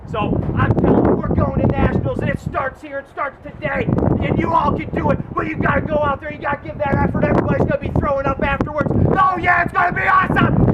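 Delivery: rally the team like a fired-up coach; fired-up pep talk. Retold or imitated. Coach firing up runners before the race, shouting. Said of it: "give 'em one of those good speeches".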